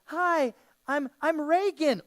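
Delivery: high, sweet little girl voice, mock Hollywood. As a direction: play the innocent girl in a horror film. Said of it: "You know, it's like".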